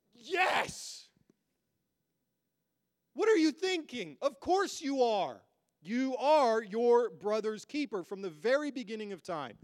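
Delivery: high, emphatic divine answer; God answering. Acted. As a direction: proclaim it as God, firm and rising high. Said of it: "And God says".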